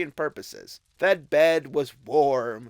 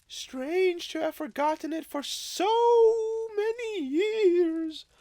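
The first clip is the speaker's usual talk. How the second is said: ghostly spirit voice. Acted. Ghost observes Scrooge.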